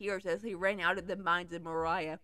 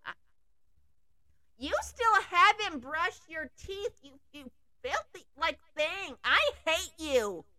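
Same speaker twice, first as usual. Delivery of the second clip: disgusted scolding; 5 semitones higher than usual. Acted. Berating the Balrog.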